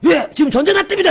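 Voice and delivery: very high, loud report; urgent shout. Retold or imitated. Young soldier shouting war news at sergeant.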